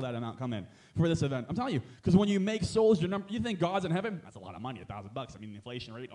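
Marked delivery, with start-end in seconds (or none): 4.2-6.1 low, much softer mock fret about money; mock worried mumble. Preacher mocking money worries, mock worried mutter.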